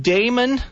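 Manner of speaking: "Damon" starting like the word day; "Damon" with a long ay first; proper pronunciation. Shown as a demonstration. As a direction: set straight "Damon" as DAY-mun. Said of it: "it's pronounced".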